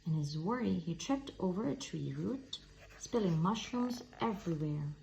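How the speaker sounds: ordinary delivery, nothing marked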